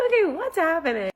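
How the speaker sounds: high, puzzled what's happening; confused surprise. Retold or imitated. Stranger grabs her phone, confused surprise. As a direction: caught off guard, half laughing.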